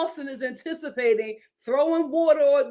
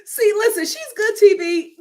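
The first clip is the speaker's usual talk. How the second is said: excited; 9 semitones higher, 7 dB louder than usual. Real, not acted. Excited aside after laughing.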